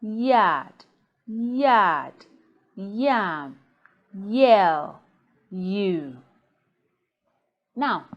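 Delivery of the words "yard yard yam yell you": "Yard, yard, yam, yell, you" each led by a clear y; word by word, drawing out "Yard", "yam", "yell", "you"; y-sound demo. Shown as a demonstration. Teacher making students hear the yi sound, careful separated words. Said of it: "Did you hear the yi sound?".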